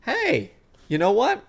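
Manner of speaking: high, lilting mock discovery; brain's sudden realisation. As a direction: voice a brain pleasantly catching on. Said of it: "our brain goes".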